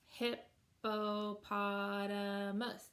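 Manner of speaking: "hippopotamus" very slow, hip-po-pot-a-mus; five drawn-out separate beats on "hippopotamus"; syllable by syllable. Shown as a demonstration. Teacher clapping a five syllable word, "hippopotamus" split into five beats. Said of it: "five syllable word".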